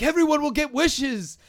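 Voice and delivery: high, loud presidential impression; Donald Trump. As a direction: boom it like a showman president. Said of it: "you're obviously doing the Trump".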